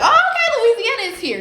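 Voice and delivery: high-pitched, startled realization; sudden high-pitched "oh, okay"; sudden urgency. Retold or imitated. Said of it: "got this sense of urgency".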